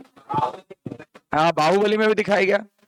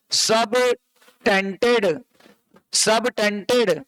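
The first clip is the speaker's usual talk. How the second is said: Subtended drawn out slowly, syllable by syllable; teacher spelling out subtended slowly; slow drilled term; much slower than usual. Shown as a demonstration. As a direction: stretch subtended out for students to repeat. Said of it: "क्या बोलते हैं?".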